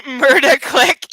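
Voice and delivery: ordinary talk, nothing marked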